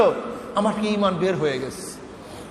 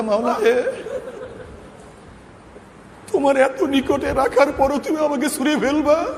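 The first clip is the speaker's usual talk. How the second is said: retold lament, imploring and raised in pitch; tearful plea; 4 semitones higher than usual. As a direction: cry out to the Lord in anguished reproach. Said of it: "আমরা অনেক কান্নাকাটি, রোনা জারি".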